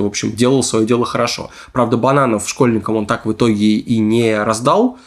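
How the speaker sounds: ordinary talk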